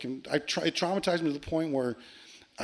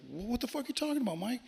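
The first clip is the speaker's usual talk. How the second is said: high, quick, softer bewildered reply; retold confused comeback, pitched up; baffled brother; 9 semitones higher, 6 dB quieter than usual. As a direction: ask it quickly in baffled protest. Brother's baffled reply to angry accusation.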